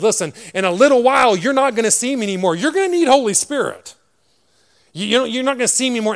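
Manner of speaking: high, loud preacher voicing Jesus; emphatic proclamation. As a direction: proclaim it forcefully to the disciples.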